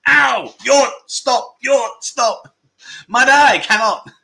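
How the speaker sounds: high, loud hammed-up yell; a jokey high-pitched yell to stop the car; mock shout. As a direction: shout it like a panicked passenger. Joking, yelling at a taxi driver.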